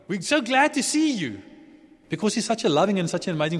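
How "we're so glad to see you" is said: high, bright-eyed joy at daddy coming home; gleeful children. Retold or imitated. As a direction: run to him squealing with delight. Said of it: "they must have run to him and said".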